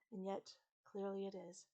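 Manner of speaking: usual speaking voice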